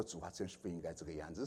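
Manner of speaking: low, hushed confession to God; quiet, subdued self-reproach; repentant prayer. Retold or imitated. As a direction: retell a private, regretful prayer very softly.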